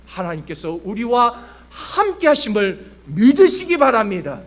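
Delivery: loud, emphatic sermon delivery; forceful preaching. Acted. Pastor to congregation on God defeating Goliath, forceful preaching. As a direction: proclaim it with full pulpit force.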